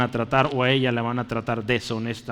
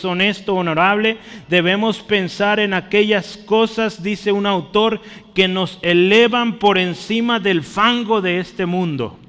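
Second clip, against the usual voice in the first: raised, higher preaching voice urging the flock; fervent preaching; 7 semitones higher, 5 dB louder than usual. Acted. Preacher urging the brothers to lift their thoughts, fervent preaching. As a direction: preach it out with conviction.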